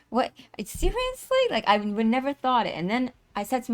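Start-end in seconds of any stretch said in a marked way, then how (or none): 0.1-1.4 high, squeaky incredulous; a squeaked what, seriously; mock disbelief. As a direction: shoot way up in mock shock. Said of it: "Or, like".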